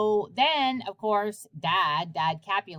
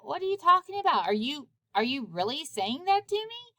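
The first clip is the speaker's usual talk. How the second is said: high, fast disbelieving outburst; as if betrayed by a friend, mildly acted; stunned Juliet; 8 semitones higher, faster than usual. Juliet reacting to the nurse's advice to marry Paris, high and rushed.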